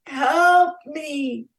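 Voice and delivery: high, loud cry for help; calling for help. A narrator's reading. Captured prince calling out as he is carried off, loud cry. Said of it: "voice calling".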